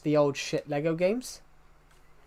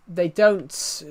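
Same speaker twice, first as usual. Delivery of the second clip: ordinary talk, nothing marked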